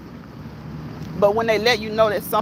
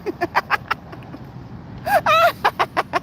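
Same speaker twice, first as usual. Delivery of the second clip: very high, breathless cackle; real laughing fit. Real, not acted. Streamer reacting to Joyelle's joke, high helpless laughter. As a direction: lose it laughing.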